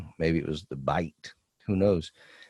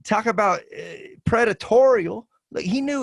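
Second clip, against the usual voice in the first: outraged disbelief; 7 dB louder than usual. Real, not acted. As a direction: let the outrage rise. Outraged about the predator.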